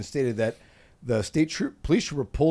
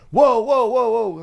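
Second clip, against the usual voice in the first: louder, higher playful alarm; real whoa; 9 semitones higher, 8 dB louder, much slower than usual. Real, not acted. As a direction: pull the joke back with a loud whoa. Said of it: "reacting to partner's joke".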